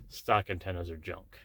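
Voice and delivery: usual speaking voice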